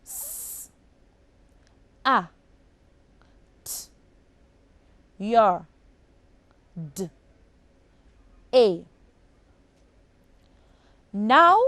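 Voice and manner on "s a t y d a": Saturday broken into six sounds; teacher segmenting the sounds; sounding out phonemes. Shown as a demonstration. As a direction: sound out each phoneme with long pauses. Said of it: "six phonemes".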